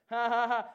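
loud, high burst of laughter; defiant laugh. Acted. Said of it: "what's he laughing about".

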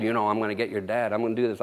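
low, quiet, fast menacing voice; devil's threat. Retold or imitated. Preacher voicing the devil threatening his dad, low and menacing. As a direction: hiss it low like a tempter. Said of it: "Oh, he said".

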